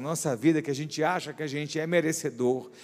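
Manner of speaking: full-force preaching. Acted. Pastor urging the church toward humility, preaching hard. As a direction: drive each phrase home to the congregation.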